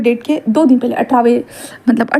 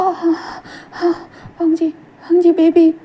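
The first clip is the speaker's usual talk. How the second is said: exhausted tearful relief; 5 semitones higher, slower than usual. Acted. Wing exhausted after birth.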